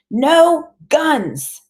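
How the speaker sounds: high, loud, emphatic refusal; high-pitched protesting grandmother; big tearful voice. A narrator's reading. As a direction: lay down the law, upset. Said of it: "her voice is big and full of tears".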